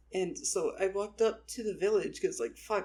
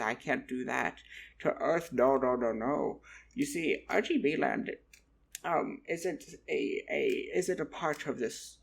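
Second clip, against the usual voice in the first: emphatic goblin, settling into a lower voice; refuse, then explain as a goblin local; goblin blacksmith; 3 semitones lower than usual. Retold or imitated. Goblin blacksmith correcting a tourist, put-on character voice. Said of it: "And he went".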